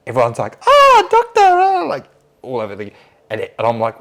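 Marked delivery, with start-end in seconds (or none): 0.6-2.0 very high, loud mock swoon; swooning nurses. Nurses fawn over the hot surgeon, high mock swoon. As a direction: squeal it like a smitten fan club.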